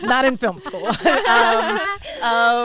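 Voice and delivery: high, loud laughter; laughing. Real, not acted. Recalling classmates' reaction, laughing hard. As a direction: crack up at the memory.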